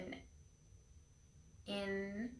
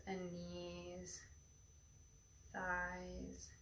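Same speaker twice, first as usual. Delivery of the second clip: airy breathy mystical healer; 9 dB quieter than usual. Acted. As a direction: breathe each word into calm stillness. Leads a guided meditation.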